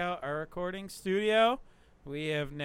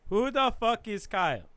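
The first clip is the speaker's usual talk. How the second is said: insistent louder repeat; 1 louder demand; 7 semitones higher, 4 dB louder than usual. Real, not acted. To the group, louder insistent demand. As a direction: demand it again, pushing harder.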